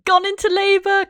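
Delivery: high, loud burst of excitement; excited shout. Real, not acted. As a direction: blurt it out in delight. Labor starts in game.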